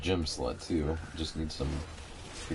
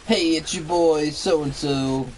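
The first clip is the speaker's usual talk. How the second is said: YouTuber intro voice; 9 semitones higher, 6 dB louder than usual. Retold or imitated. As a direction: ham up a gaming channel greeting. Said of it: "dude bros who always start up".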